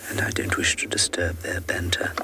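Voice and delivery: very soft, hushed commentary; whispering. Real, not acted. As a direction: whisper so the nearby vehicle isn't disturbed. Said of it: "I'm whispering very softly".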